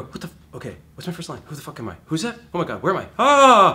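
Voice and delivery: rising panic ending in a loud high cry; mock panic. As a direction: spiral into a hammed-up panic. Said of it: "And you start panicking".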